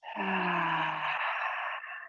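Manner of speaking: long, soft, low breathy sigh; drawn-out releasing sigh; sigh out the mouth. Shown as a demonstration. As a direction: exhale slowly and quietly through an open mouth. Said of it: "Out the mouth".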